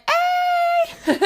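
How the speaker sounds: high giddy squeal breaking into laughter; sudden high laughing yelp. Real, not acted.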